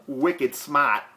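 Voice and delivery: joking Boston accent. Shown as a demonstration. Man jokingly denying his accent, in broad Boston. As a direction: play up the Boston vowels. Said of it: "I'm from Boston. But I don't talk like that".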